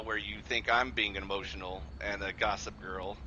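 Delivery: ordinary delivery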